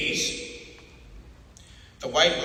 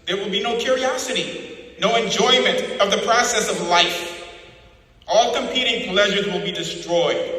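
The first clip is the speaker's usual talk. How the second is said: cold lecture swelling louder toward the list of what will vanish; O'Brien's creed; 2 semitones higher, 6 dB louder than usual. Acted. Interrogator to Winston, rising louder cold proclamation. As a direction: hammer each "no" home. O'Brien describes the party's world.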